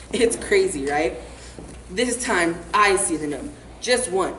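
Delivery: ordinary talk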